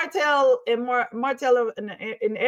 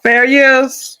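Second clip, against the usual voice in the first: big, loud announcement cutting in; loud interjection; 3 semitones higher, 11 dB louder than usual. Real, not acted. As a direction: call out loudly over a playing clip. Calls out over played clip.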